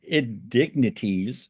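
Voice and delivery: INDIGNITIES sounded out slowly, syllable by syllable; slow, deliberate "indignities" after tripping over it; careful retry. Real, not acted. Host retries a stumbled word while reading, careful.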